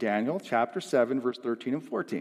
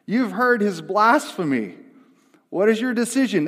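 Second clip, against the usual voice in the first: high, loud accusing questions; raised voice, impassioned accusation; outraged high priest; 8 semitones higher, 6 dB louder than usual. Acted. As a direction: proclaim it to the court in outrage. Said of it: "the high priest, he tore his garments and said".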